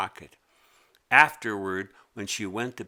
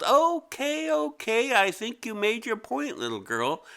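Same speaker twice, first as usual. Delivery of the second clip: much higher, giggly creature voice; 11 semitones higher, 4 dB louder than usual. Acted. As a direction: squeal it out, tickled. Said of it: "The yellow thing giggled".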